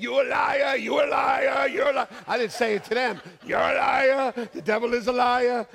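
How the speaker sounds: a pastor shouting down bad news in hospital; shouting rebuke. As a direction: rebuke the devil at full voice.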